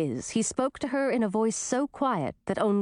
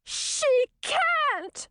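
very high, indignant squeal; high-pitched princess outburst; blurted protest. A narrator's reading. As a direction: blurt it out in shrieky disbelief. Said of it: "blurted Chartreuse".

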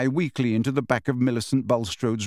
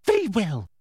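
lightly raised, prim voice; a hint of a simpering witch official; Umbridge's voice; 6 semitones higher than usual. A narrator's reading. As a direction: Umbridge making up her mind. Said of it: "she said".

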